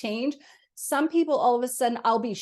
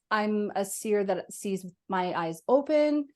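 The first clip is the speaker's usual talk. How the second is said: ordinary talk, nothing marked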